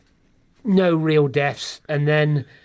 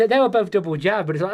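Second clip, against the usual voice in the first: ordinary delivery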